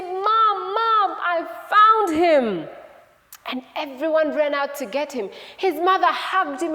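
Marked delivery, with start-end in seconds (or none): 0.0-2.7 high, loud, stretched-out call to Mom; drawn-out excited holler. Acted. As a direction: shout the news across the yard. Sister calls mom about her find.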